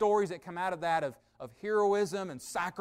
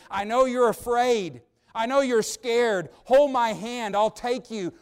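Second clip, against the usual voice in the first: loud, raised-pitch proclamation; emphatic preached quote; 5 semitones higher, 8 dB louder, slower than usual. Acted. Preacher voices what God didn't say to us, emphatic. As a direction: project God's imagined words, high and forceful.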